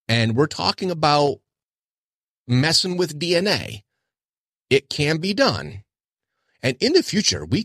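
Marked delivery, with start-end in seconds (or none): none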